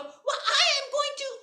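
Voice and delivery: high, loud furious cry; angry threat. Acted. Mariko threatening the kappa, high and loud. Angry girl raising ladle.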